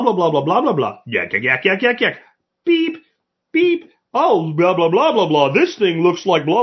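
squeaky beeps and blah blah chatter, mocking. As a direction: rattle off beeps and blahs like a scanner. Imitating chatty shoppers at checkout.

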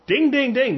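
high imitated bell dings; quiz bell. Host reveals the quiz answer, mock bell. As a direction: ring in the right answer.

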